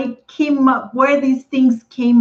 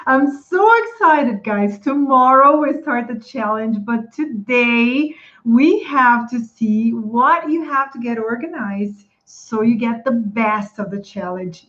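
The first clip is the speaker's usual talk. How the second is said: ordinary talk, nothing marked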